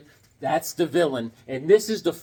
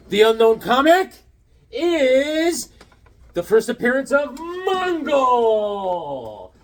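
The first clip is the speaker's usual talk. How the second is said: excited announcement; 6 semitones higher, slower than usual. Real, not acted. Big reveal of a comic.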